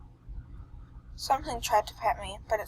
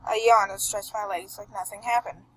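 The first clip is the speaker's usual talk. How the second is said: ordinary delivery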